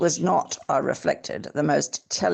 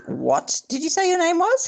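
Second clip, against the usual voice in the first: much higher, lighter voice for the host; host's high voice; 13 semitones higher than usual. Acted. Reader voicing the host, high and curious. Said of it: "he asked".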